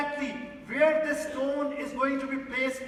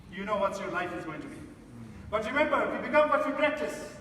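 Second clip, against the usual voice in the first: ordinary talk, nothing marked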